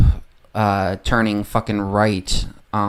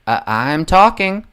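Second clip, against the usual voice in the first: raised, louder, slow mock retort; retold rebuke; 7 semitones higher, 5 dB louder, slower than usual. Imitating a candidate answering critics on Israel, mock rebuke. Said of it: "her response was".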